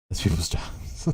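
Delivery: breathy whisper under the breath; sheepish whispered aside. Real, not acted. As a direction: drop to a whisper, half to yourself. Muttered aside after laughing.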